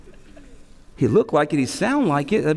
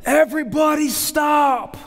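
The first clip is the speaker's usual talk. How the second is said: aggressive coach; 12 semitones higher than usual. Retold or imitated. Six-year-old coach stopping the game, high slow shout. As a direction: bark it like an overeager coach. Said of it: "the dude runs out all aggressive".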